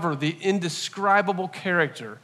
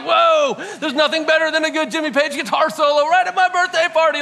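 excited whoa, pitched way up and louder; gushing with delight like a rock fan; thrilled; 11 semitones higher, 6 dB louder than usual. Retold or imitated. Recalling his joy as Led Zeppelin kicked on, excited and high. Thrilled hearing [name] solo.

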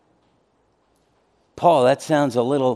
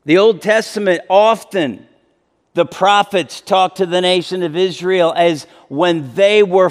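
higher, louder impassioned sermon; full-force preaching; 7 semitones higher, 3 dB louder than usual. Acted. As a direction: preach it with rising fire.

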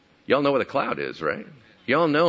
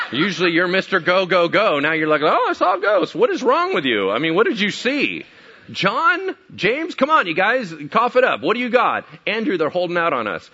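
hurrying, pestering disciple, higher voice; impatient Bartholomew; 7 semitones higher, 5 dB louder than usual. Acted. Left-behind disciple quizzing the returning three, impatient and pressing. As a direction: chivvy them, growing more insistent.

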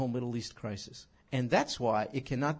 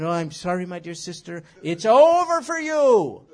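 hammed-up condemning church member; mock harsh verdict; 3 semitones higher, 12 dB louder than usual. Preacher voicing a harsh judgement on a straying sister, mock loud. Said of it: "No, you could say".

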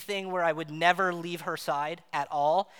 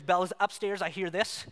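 ordinary talk

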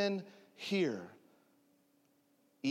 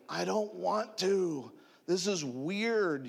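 put-on slow, higher-pitched childish protest; whiny reluctance; 4 semitones higher, slower than usual. Man balks at asking his robbers, whiny reluctance. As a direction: drag it out like a balking kid. Balking at the Holy Spirit's order.

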